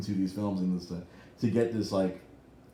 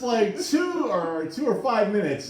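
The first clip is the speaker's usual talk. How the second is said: mock incredulous; 11 semitones higher, 3 dB louder, slower than usual. Exaggerated recall of opening.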